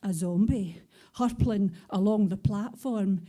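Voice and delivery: rhythmic spoken-word recital in Scots; punchy, rhythmic poem delivery; poem performance. Acted. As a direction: perform it like a live poetry slam.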